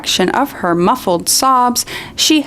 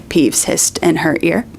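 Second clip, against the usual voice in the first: ordinary talk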